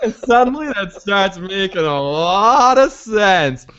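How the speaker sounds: slow, high, drawn-out mock realization through laughter; leering hammed-up dawning interest; Howard Stern moment. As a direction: stretch it out slowly, laughing, as if suddenly persuaded. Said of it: "like the Howard Stern moment".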